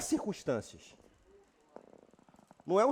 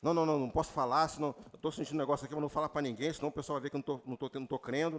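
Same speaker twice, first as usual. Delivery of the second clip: fast, low, hushed worried babble; mock fearful mumble; 6 semitones lower, 5 dB quieter, much faster than usual. Preacher mimicking a fearful believer hiding pain. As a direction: hide it nervously. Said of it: "A circunstância tá lá".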